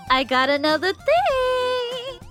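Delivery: high, drawn-out sing-song coaxing; slow, high sweet-talk before asking a favour; wheedling. Acted. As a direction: float it up sweetly and stretch the end.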